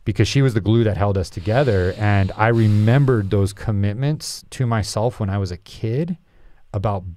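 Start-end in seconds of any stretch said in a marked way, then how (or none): none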